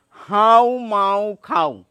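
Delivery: হাউ মাউ খাও drawn out through the nose; slow nasal ghost chant; nasal pronunciation. Shown as a demonstration. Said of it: "নাকের সাহায্য নিতেই হবে".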